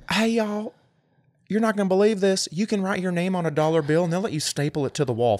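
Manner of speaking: high, quick, gushing y'all voice; mock amateur reviewer. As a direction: imitate an excited reviewer, high and fast. Mimicking an amateur restaurant reviewer.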